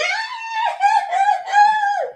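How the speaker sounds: high, loud shriek of laughter. Real, not acted. Laughing about being young and dumb, a shrieking cackle. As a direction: burst into a squealing cackle.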